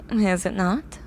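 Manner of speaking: ordinary talk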